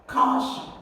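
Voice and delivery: high "Caution", first syllable stressed; "Caution" as a high model word, weight up front. Shown as a demonstration. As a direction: hit the CAU high in "Caution". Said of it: "you're gonna stress the first syllable".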